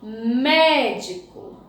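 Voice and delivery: Médico slow, with a long stressed mé; strong syllable aloud. Shown as a demonstration. Teacher showing pupils the strong syllable, Médico with drawn-out mé. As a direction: stretch the first syllable of Médico. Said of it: "A sílaba forte é o mé também".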